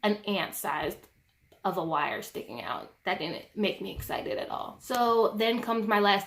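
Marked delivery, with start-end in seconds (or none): none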